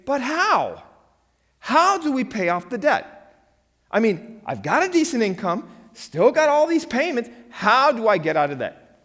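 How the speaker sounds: high, loud pleading Charlie Brown voice. Acted. Pastor voicing Charlie Brown begging to escape debt, high and loud. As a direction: play Charlie Brown, whining about debt. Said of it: "if Charlie Brown were, he would say".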